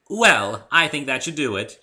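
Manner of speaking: loud high refined pony; grand unicorn flourish; Rarity voice. A narrator's reading. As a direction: elegant Rarity finishing. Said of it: "she said".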